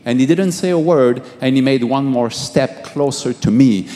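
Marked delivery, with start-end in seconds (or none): none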